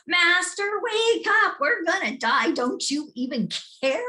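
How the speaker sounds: high, loud frantic pleading; panicked shout. Acted. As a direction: shake him awake yelling in fear. Disciples waking Jesus in panic.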